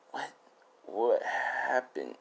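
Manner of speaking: slow, soft waking-up question; acted morning-after daze; groggy confusion. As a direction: draw it out as if just coming to. Voicing him waking up confused.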